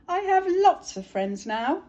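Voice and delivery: high, loud, slow echoing reply; echo answering. Acted. Echo answering Little Beaver from across the pond. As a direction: call back like a far echo. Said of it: "From across the pond, a voice answered".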